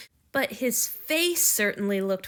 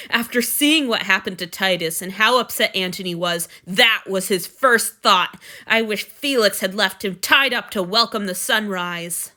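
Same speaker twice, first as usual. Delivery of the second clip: louder, heated indignation; angry rant; 8 dB louder than usual. Acted. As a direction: vent your fury at him. Julia furious at Marcus.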